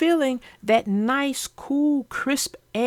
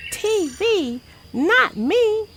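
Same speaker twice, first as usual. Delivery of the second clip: put-on much higher, bright little-girl cub voice; Sister Bear; 10 semitones higher, slower than usual. Sister Bear answering Brother, high dismissive cub voice. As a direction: scoff at TV like a proud little cub. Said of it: "Said Sister Bear".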